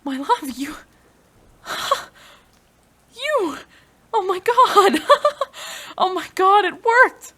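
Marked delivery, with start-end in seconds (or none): 1.6-2.3 a sudden shocked intake of breath; sharp gasp. Acted. Scientist seeing her lover move, sharp shocked gasp. 3.1-5.1 much higher and louder, overwhelmed; stunned cry. Acted. Scientist seeing her lover revive, stunned high cry. 6.0-7.3 high, loud laugh breaking into the words; giddy laughing. Acted. Scientist whose lover has revived, giddy laughing. Experiment succeeds.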